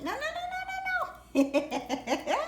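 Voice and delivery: laughing through a string of no's; real laughter while scolding playfully. Real, not acted. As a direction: giggle out the no's. Laughing as pet grabs treat.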